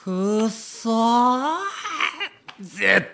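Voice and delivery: a drawn-out slow "くそー" then a half-laughing vow to win; 悔しさをにじませて; frustrated grumble. Acted. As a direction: swallow the insult and mutter revenge. Reporter stung by rival's mockery.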